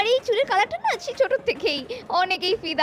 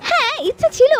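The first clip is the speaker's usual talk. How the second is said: high, loud eager burst; eager yes; 7 semitones higher, 6 dB louder than usual. Acted. Girl eagerly telling the photographer she once dreamed of acting. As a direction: jump at the idea.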